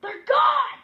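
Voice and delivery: high panicked shout. Acted. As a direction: yell it out in panic. Panicked shout about lost glasses.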